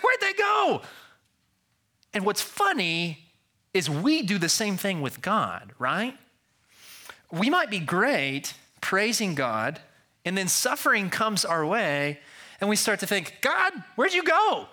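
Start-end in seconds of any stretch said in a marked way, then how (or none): 0.0-0.8 high-pitched, louder mock wail; mock toddler scream. Father imitating his little daughter left alone, screaming in mock panic. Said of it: "so she starts screaming". 13.4-14.7 high-pitched cry of mock abandonment; mock panicked cry. Preacher voicing sufferers who lose sight of God, in mock panic. Echoes the screaming child to God.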